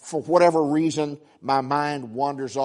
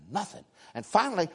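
normal talking voice

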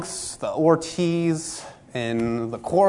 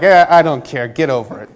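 dismissive; 8 dB louder than usual. Retold or imitated. Friend brushes off complaint.

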